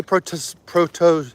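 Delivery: normal talking voice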